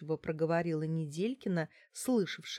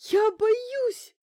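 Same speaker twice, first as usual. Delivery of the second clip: very high, loud drawn wail; frightened wailing; 9 dB louder than usual. A narrator's reading. Bankina too scared to follow orders, wailing. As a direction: wail it at the top of the range. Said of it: "запричитала Банкина".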